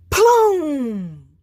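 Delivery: sudden very loud boom. Acted. Man's gun going off again, loud bang. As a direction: fire it off like a big gun. Gunshot sound.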